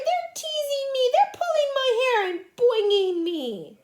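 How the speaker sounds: high, loud wail of complaint; crying out. Acted. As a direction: tattle to the teacher in a wail. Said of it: "she cried".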